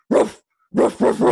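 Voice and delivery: loud mock barking like a dog. Barking with her dog at a stranger, loud and playful. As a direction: bark out loud along with the dog. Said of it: "I go and I bark".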